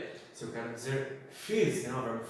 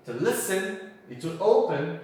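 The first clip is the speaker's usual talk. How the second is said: listen and open, LI and O punched louder; stress on first syllables; 4 semitones higher, 5 dB louder than usual. Shown as a demonstration. Teacher recaps the rule, first syllables stressed in listen and open. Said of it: "A sílaba tônica no início".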